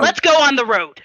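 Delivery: loud, high-pitched urging to set off; rallying call. Acted. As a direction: call the party to move out. Half-orc rallies the party to leave.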